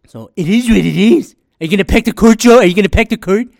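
loud, high mocking call-out; taunting shout. Taunting Joe about packing the court, mock shout.

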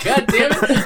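high mock cursing, half laughing; imitated exasperated delivery. Villain fed up with careless guards, mock exasperated. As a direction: curse in comic exasperation. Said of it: "It's like how he delivered that line".

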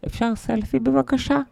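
put-on high, slow little-kid voice; child fan. Kid interrupts the row for a selfie, high child's voice. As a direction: put on a high voice for a polite request. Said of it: "מגיע ילד".

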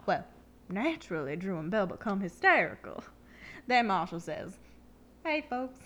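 Southern lady telling a story; Southern accent. Acted. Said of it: "my Southern accent".

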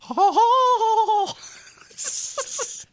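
high squealing laugh. Real, not acted. Laughing after ghost voice.

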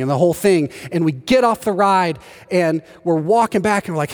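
normal talking voice

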